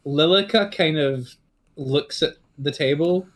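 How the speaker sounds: normal talking voice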